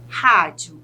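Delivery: "rádio" with RÁ stressed, falling away; stressed syllable exaggerated. Shown as a demonstration. Teacher has students find the stress, "rádio" stressed on RÁ. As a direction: lean on the first syllable of "rádio". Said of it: "tente analisar qual dessas sílabas é a sílaba tônica".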